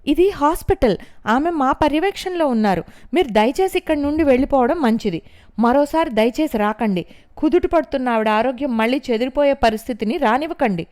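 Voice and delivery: high, loud stern dismissal; firm order. A narrator's reading. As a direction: send her out with authority. Nurse orders family out.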